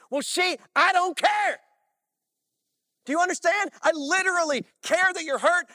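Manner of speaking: loud, high, impassioned preaching; preaching at full force. Acted. Pastor pressing the congregation to love, preaching at full force. Preacher driving home the point.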